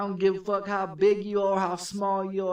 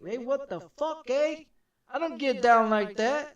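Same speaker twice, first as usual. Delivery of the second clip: higher, louder yell of protest; retold angry yell; 6 semitones higher, 3 dB louder than usual. Retelling how he yelled at the inmate who grabbed him, angry higher shout. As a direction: yell it like you're pushing him off.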